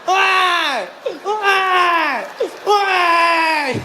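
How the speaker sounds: hammed-up high whiny baby cries. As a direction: wail like a spoiled baby.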